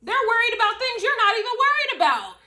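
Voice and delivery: high, loud declaration; loud exclamation. Real, not acted. Reader proclaims to viewers that haters worry needlessly, loud and high. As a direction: call it out like a revelation. Peak of the reading.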